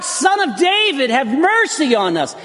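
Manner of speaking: high pleading cry; a hint of a desperate cry. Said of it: "they begin to cry out".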